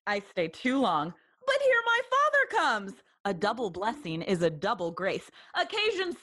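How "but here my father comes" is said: high-pitched heads-up; sudden lift in pitch as someone arrives; hurried announcement. Acted. Spots father arriving mid farewell.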